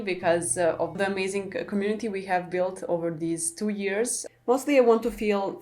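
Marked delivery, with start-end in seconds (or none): none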